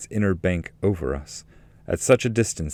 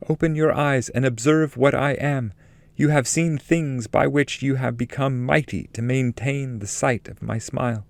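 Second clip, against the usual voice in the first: higher, exalted guiding lady; higher, solemn voice of a holy lady; Beatrice's voice; 7 semitones higher than usual. Acted. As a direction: speak as a radiant heavenly guide, lifted up in pitch. Beatrice speaks within the poem.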